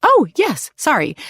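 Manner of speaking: very high, bright chirped reply; chirruping. A narrator's reading. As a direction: chirp it out cheerfully. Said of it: "she chirrups".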